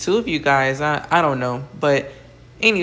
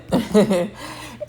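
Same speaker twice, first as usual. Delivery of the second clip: short higher-pitched chuckle; amused chuckle; 5 semitones higher than usual. Real, not acted. Recapper amused at the bunk bed in the basement, chuckling. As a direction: let out a brief laugh to yourself.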